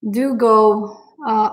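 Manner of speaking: ordinary delivery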